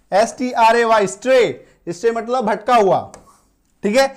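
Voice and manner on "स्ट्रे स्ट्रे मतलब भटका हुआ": normal talking voice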